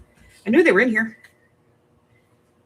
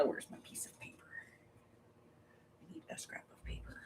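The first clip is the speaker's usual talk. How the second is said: unvoiced whisper while searching; whispering to herself; 10 semitones higher, 26 dB quieter, faster than usual. Real, not acted. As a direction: whisper it under your breath.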